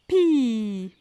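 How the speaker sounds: "Pi" with a long held i; "Pi" stretched and falling for careful listening; final sound stretched. Shown as a demonstration. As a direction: draw out the vowel of "Pi". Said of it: "écoutez bien".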